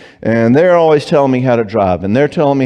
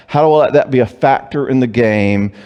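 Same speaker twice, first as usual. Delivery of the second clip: usual speaking voice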